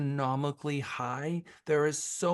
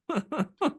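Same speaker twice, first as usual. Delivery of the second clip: high laugh; 13 semitones higher than usual. Real, not acted. Laughs after singing.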